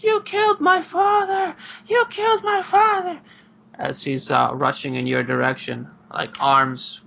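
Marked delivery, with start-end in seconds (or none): 0.0-3.2 put-on high, loud child's scream of accusation; furious kid yelling at armed strangers. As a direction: scream it like a grieving boy. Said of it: "screaming at you guys".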